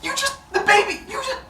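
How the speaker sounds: very high, sputtering disbelief; hammed-up panicked stammer, way up high; mock freak-out. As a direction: play a horrified mother lost for words. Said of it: "Rhea freaks out".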